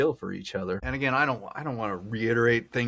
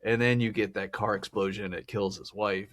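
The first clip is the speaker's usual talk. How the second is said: ordinary talk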